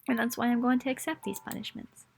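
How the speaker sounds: usual speaking voice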